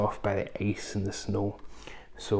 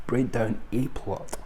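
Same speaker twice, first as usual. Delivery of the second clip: ordinary talk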